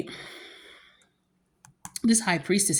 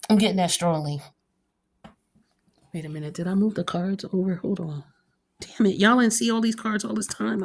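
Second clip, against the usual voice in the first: ordinary talk, nothing marked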